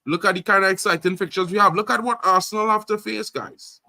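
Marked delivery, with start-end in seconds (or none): none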